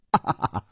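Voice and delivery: short rippling chuckle; gloating laugh. Acted. Villain explains his trick, gloating laugh. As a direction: break off into a self-pleased laugh.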